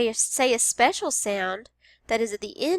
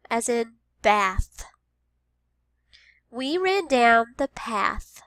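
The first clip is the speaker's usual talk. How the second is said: slow, clear, path stretched; slow teacher modeling; slow model words; 4 dB louder, slower than usual. Shown as a demonstration. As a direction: say it slowly. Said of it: "What word has the th sound".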